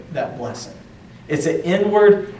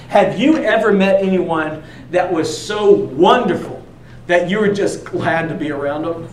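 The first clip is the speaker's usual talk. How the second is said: normal talking voice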